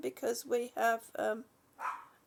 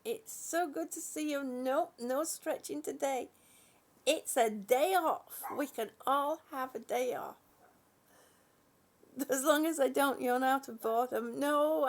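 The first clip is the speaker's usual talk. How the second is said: normal talking voice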